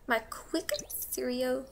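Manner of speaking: slow whisper. Real, not acted. An aside about the Sim's cereal, whispered slowly. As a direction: breathe it under your breath.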